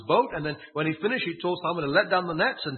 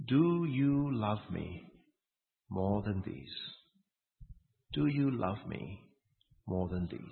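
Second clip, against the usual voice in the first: tender question; 7 semitones lower, 5 dB quieter, slower than usual. Acted. Jesus's question read tenderly.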